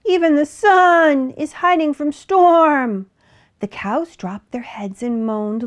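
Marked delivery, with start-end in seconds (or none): 0.0-3.1 high, loud, slow lament; dismayed sheep voice; bleating sheep. A narrator's reading. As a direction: wail it like a worried sheep, drawing words out. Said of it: "bleated the sheep".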